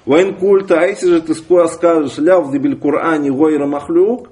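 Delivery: normal talking voice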